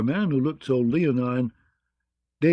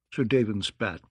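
ordinary delivery

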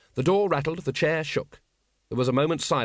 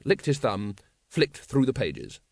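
ordinary delivery, nothing marked

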